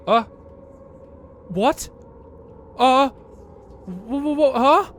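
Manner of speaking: jolted by a sudden shock, stammering; startled. Real, not acted. Startled by something sudden in game.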